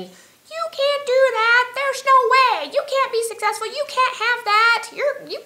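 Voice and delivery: high, loud, slow and taunting doubter; nagging inner voice. Acted. The doubting inner voice mocking her goals, high and loud. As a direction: sneer it down at yourself like a nagging critic. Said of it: "the little voice that's in the back of our head saying".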